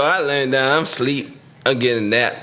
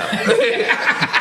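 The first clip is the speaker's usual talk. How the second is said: breathy laugh; 11 semitones higher than usual. Real, not acted. Laughing at own fight story.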